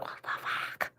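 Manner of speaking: barely audible, voiceless exclamation; hushed, breath-only shock; shocked whisper. Acted. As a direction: whisper it under your breath in disbelief. Mouthing startled reaction to noise.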